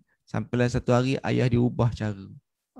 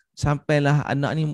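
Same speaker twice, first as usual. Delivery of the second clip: usual speaking voice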